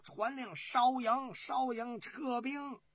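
high, drawn-out repeated command; shouted order. Acted. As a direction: call the retreat across the camp. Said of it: "朱全忠喊道". (高声拖长喊令)

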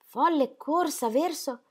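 domanda lenta e sbigottita; stupore incredulo. Acted. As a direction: non crede a ciò che ha sentito. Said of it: "tanto sconfinato era il suo stupore". (il marito, sbalordito, chiede lentamente)

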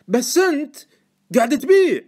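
high, loud, emphatic accusation; aghast protest. A narrator's reading. Informant accusing Deacon of selling, aghast shouting. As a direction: accuse him in loud disbelief.

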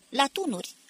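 high, urgent rallying call; shouted command. A narrator's reading. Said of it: "strigă Barca".

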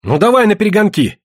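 high, indignant retort; touch of hot temper, pitched high; flaring up. A narrator's reading. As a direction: bristle and take up the challenge. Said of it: "Васька вспылил".

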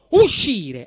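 normal talking voice

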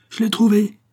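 high, loud cry of discovery; an inspector crying out to the workers that he found the body; exclaiming. Acted. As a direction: call it out as you find him. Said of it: "s'exclama-t-il".

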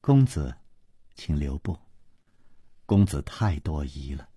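quiet, gentle lady calling him back; soft consort voice. A narrator's reading. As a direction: softly call him to stay. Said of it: "秋瓷王妃却笑着说".

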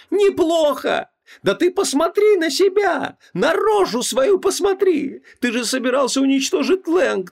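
very high-pitched furious screaming; wild yell. A narrator's reading. Wife screaming at husband to look at himself, furious. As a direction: scream at him, stretching the words. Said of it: "дико заорала Ванесса".

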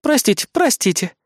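high, lightly amused apology; smirking apology. A narrator's reading. Fury to the warning captain, smirking. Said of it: "усмехнулась Фурия".

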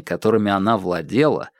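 plain reading voice

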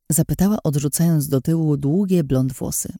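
ordinary delivery

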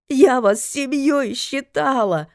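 tearful shout, slow and high; angry crying. A narrator's reading. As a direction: cry it out through sobs, hurt. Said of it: "сквозь слёзы прокричала Элиза".

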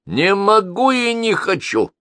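high, slow, sneering refusal; grating, disagreeable old crank; nasty voice. A narrator's reading. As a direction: make the voice as unpleasant as you can. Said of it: "Голос был не просто неприятным".

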